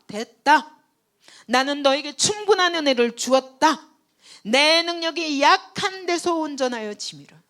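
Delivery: full-force pulpit preaching. Acted. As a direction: thunder it out to the congregation.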